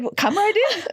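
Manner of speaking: high chirpy receptionist; mock friendly clerk. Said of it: "and they said".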